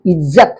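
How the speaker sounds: "इज्जत" with a buzzed zz in the middle; Z sound. Shown as a demonstration. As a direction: say "इज्जत" as izzat, not ijjat. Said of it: "ऐसा ज का प्रयोग होता है". ("इज्जत" में नुक्ते वाला ज़)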